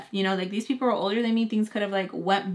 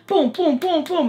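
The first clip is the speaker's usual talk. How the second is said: imitated thumping noise; 8 semitones higher, 5 dB louder than usual. Imitating phone-call noises through wall.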